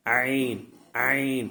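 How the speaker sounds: عین sounded loud from the throat; عین with a strong, deliberate pharyngeal onset; clear ʿayn sound. Shown as a demonstration. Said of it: "عین کی آواز نکالیں".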